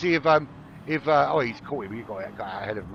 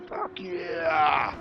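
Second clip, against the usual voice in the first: higher, drawn-out American cheer; mock American; 6 semitones higher than usual. Commentator hyping a driver, mock American accent. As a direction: whoop it like a hammed-up American patriot. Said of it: "the American".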